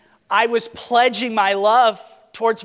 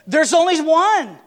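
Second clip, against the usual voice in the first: firm insistence; 8 semitones higher, 7 dB louder than usual. Retold or imitated. Wife firmly insisting.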